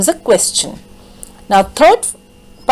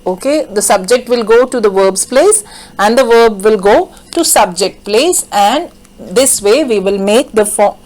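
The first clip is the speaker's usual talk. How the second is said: usual speaking voice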